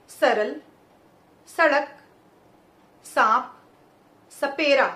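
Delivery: each word slow and loud with स at the teeth; सरल सड़क सांप सपेरा with tongue behind teeth; clear dental s demo. Shown as a demonstration. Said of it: "बोल के देखते हैं कुछ शब्द". (दांतों के पीछे जीभ रखकर साफ़ स बोलना)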